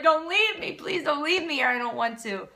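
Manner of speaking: high, loud panicked plea; mock desperate begging, like a child being left; pleading child. Acting children who run after parent.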